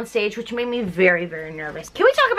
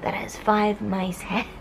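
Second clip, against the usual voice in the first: hushed whisper; 4 semitones lower, 7 dB quieter, slower than usual. Real, not acted. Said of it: "hushed telling".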